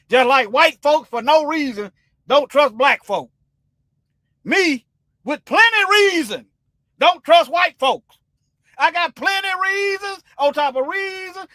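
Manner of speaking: rising fury, pitch climbing high; heated rant. Real, not acted. Speaker to his viewers about distrust, a heated high outburst.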